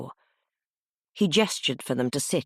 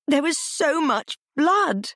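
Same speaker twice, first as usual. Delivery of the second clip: bursting into tears; 13 semitones higher, 5 dB louder, slower than usual. A narrator's reading. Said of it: "Clementine burst into tears".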